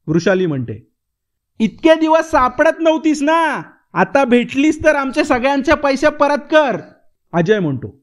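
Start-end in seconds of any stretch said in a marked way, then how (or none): 1.6-6.8 corner her and demand the money; accusing demand. Acted. Ajay accuses Vrushali of hiding, demanding money. Heated money quarrel between friends.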